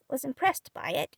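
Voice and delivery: usual speaking voice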